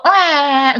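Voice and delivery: whiny imitation crying; imitated child's wail. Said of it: "Jadi duduk".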